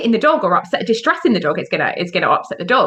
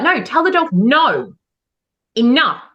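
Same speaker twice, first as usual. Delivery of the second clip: firm no; 3 semitones higher, 4 dB louder, slower than usual. Retold or imitated. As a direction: drive home "no" and "enough". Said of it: "It's okay to be firm".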